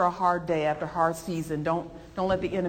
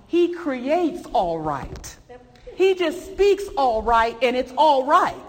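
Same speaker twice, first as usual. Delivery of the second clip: rising, louder preacher's cry, slowed on all right; impassioned pulpit proclamation; fervent preaching; 6 semitones higher, 7 dB louder, slower than usual. Acted. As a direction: proclaim it like a Sunday climax. Preaching at full force.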